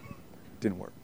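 whispered, soft reply; quiet dramatic answer; hushed answer. Real, not acted. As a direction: drop to a near whisper. Answers own question hushed.

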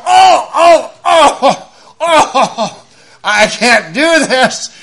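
high, loud laughing groans, then slow; strained groaning laugh. Retold or imitated. Retelling his own struggling.

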